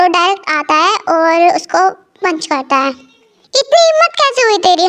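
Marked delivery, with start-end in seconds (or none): 2.9-4.9 higher, slow furious challenge; angry hero. Acted. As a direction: spit it at the thug who grabbed her. Hero punches the harasser.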